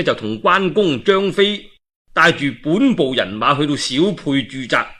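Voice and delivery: usual speaking voice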